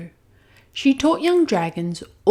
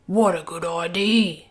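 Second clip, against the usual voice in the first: lightly voiced young dragon; a grateful dragon, lightly voiced; Zog. Zog answers.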